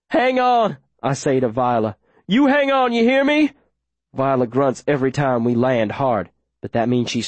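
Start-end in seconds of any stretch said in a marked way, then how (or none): 0.1-0.8 high, urgent command; urgent plea. A narrator's reading. Runner to the wounded girl he carries, urgently pleading. Urges wounded Viola while running. 2.3-3.5 high, urgent command; urgent plea. A narrator's reading. Runner to the wounded girl he carries, urgently pleading. Urges wounded Viola while running.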